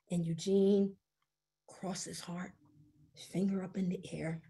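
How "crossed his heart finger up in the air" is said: hushed, breathy whisper. A narrator's reading. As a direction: whisper it like a secret vow. Solemn promise in narration.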